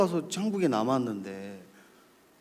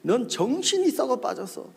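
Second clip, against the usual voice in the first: high, heated telling-off; acted pastor's rebuke, pitched up; scolding pastor; 10 semitones higher than usual. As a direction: lay into a stubborn junior. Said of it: "하시면 막 뭐라 하시다가".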